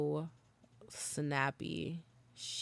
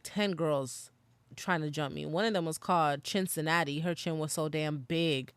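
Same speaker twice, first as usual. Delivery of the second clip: normal talking voice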